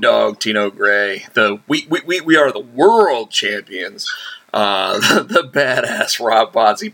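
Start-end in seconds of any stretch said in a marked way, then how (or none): none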